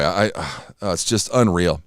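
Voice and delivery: normal talking voice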